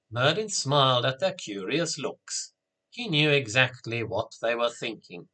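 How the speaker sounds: usual speaking voice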